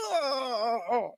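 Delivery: high, soft pained yelp; stabbed cry. Retold or imitated. The guy landing on Freddy's spikes, high soft pained yelp. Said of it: "He's like".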